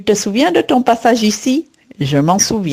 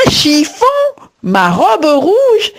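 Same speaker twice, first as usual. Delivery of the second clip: outraged disbelief; 7 semitones higher, slower than usual. Acted. Wife outraged about her dress.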